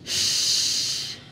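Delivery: Shh hissed softly with air spread across the mouth; shin hiss. Shown as a demonstration. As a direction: blow a soft spreading Shh. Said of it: "itu adalah makhraj huruf shin".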